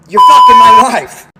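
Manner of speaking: screaming it way up high, full force; furious shout. Retold or imitated. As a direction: explode at him like a jealous husband. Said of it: "he goes".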